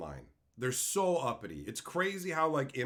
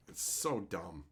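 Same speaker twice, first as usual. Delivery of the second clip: normal talking voice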